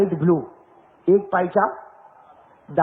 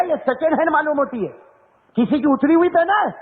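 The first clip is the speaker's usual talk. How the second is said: higher-pitched mock teasing question; 8 semitones higher than usual. Preacher teasing the young man about second-hand jeans, playfully mocking. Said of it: "میں نے جان بوجھ کے اس کو چھیڑا".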